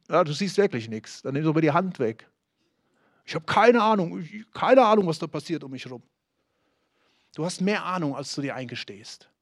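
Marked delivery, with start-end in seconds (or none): 3.2-6.0 a put-on voice playing dumb, stressing keine; mock clueless excuse. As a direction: feign helpless ignorance, fading out at the end.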